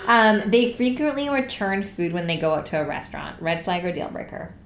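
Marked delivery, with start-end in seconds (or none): none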